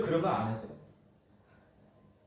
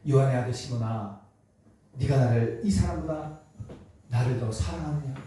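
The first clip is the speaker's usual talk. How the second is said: gentle asking; 6 semitones lower than usual. Retold or imitated. Jesus gently asking Peter, lower and tender. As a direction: ask Peter kindly, without blame. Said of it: "베드로에게 와서 ... 물어요?".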